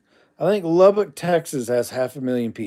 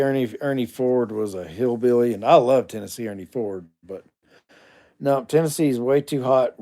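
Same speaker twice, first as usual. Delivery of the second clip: ordinary delivery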